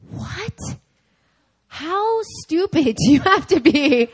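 high, loud, slow outraged yell at her daughter; imitating mom exploding in disbelief; furious mom. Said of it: "went ballistic on me".

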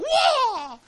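high, loud, drawn-out astonished cry; hammed-up shout of amazement; amazed whoa. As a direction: yell it as if stunned by your own power. Student amazed by his punch.

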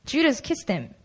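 normal talking voice

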